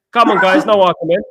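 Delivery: loud appeal for calm. Real, not acted. Streamer telling arguing viewers to stop, loud and firm. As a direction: raise the voice over the squabble, firm but friendly.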